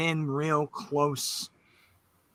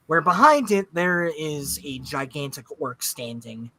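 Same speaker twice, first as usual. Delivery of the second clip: normal talking voice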